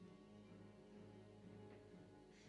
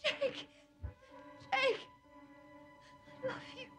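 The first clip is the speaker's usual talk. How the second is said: desperate calling; 13 semitones higher, 5 dB quieter than usual. Acted. Wounded woman calls after him.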